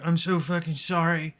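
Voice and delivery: pleading, overly apologetic sick voice; whiny sick apology. Retold or imitated. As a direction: beg like a miserable patient. Imitating self sick, apologizing to mom.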